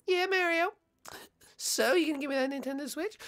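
soft, high coaxing; near-whispered falsetto wheedling; Peach. Acted. Peach coaxes Mario.

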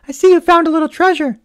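high, gentle mother voice; Mama's voice. A narrator's reading. Mother admiring her daughter's find, high and gentle.

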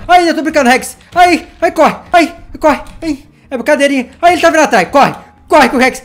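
high, loud yelps while running away; mock-scared cries fleeing the T-Rex; playful panic. Real, not acted. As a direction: squeal and run from the angry dinosaur.

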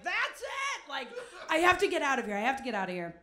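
sudden high burst, then a loud push; the moment you finally crack and decide to leave; snapping point. Retold or imitated. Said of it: "I was like".

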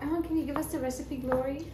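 imagined viewer. Acted. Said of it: "someone will be like".

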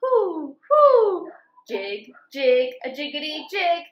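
lively sing-song picture book reading; reading to children. A narrator's reading. Teacher reading farm story to children, lively sing-song. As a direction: read it bouncy, like for little kids.

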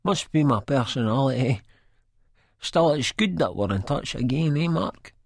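lightly voiced Spud, eager and hopeful; eager chunter. A narrator's reading. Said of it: "he chunters, all eager and hopeful".

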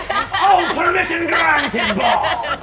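high, loud, laughing shout; hearty pirate captain. Acted. Pirate captain granting permission to the crew, heartily shouted. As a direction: roar approval like a pirate.

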